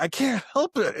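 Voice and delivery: high, whiny protest; whiny coworker voice. Retold or imitated. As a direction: whine it like a helpless friend. Tiffany's reply, voiced by friend.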